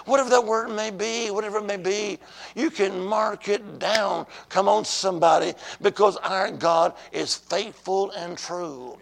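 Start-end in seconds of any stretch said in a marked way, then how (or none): none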